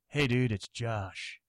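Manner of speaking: slow, low dude voice; laid-back manager. Retold or imitated. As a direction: drawl it like a chill guy. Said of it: "He's like".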